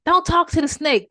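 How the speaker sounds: loud high warning; urgent warning. Acted. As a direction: warn him sharply and loud. Passerby warning the man.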